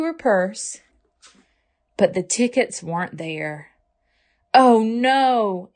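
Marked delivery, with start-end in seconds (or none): 4.5-5.7 high, louder gasped oh no; gasping. Acted. Mother gasping at the missing tickets. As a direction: gasp in sudden dismay. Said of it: "Ava's mother gasped".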